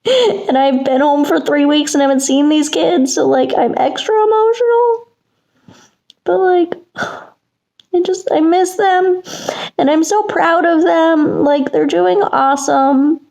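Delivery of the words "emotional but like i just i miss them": emotional. Real, not acted. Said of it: "I'm just emotional".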